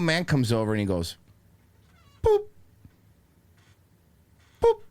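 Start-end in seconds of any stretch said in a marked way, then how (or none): 2.2-4.8 short high "boop"; imitated scanner beep. Cashier scanning items slowly, imitated beeps. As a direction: mimic a checkout beep, one at a time.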